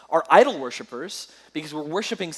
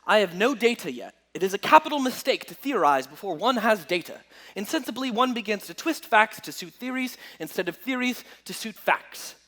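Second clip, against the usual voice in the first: lightly put-on British accent, quoting Sherlock; a half-hearted British accent for Sherlock's words; bad British accent; 4 semitones higher than usual. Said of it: "my horrible British accent".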